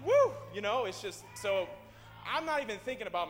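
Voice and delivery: loud, high joyful outburst with a shouted woo; excited. Real, not acted. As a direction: caught up in the rush of joy. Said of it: "I'm getting excited".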